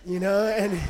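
normal talking voice

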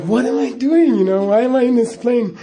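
high, loud, laughing panic; panicky self-question. Retold or imitated. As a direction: relive the terror of the drop, half laughing. Panicking on the dropping plane.

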